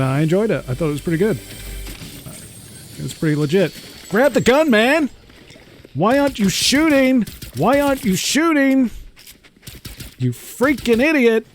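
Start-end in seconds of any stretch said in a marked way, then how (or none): 4.1-5.1 frustrated yelling. Real, not acted. Yelling at teammate mid-fight. 5.9-7.2 frustrated yelling. Real, not acted. Repeated yell at teammate. 7.6-11.5 frustrated yelling. Real, not acted. Yelling at teammate mid-fight.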